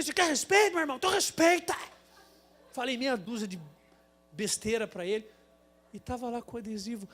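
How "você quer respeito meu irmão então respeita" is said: high, loud challenge back; angry retort. Retold or imitated. Driver snapping back at the squeegee man, angry. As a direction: throw his word back at him. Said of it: "Eu falei".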